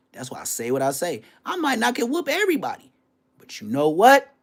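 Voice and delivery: normal talking voice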